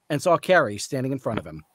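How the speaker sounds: ordinary delivery, nothing marked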